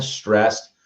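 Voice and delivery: ordinary talk, nothing marked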